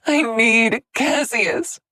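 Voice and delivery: high, loud, slow gasping plea. Acted. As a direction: struggle for air as you beg. Scarlet gasping for air.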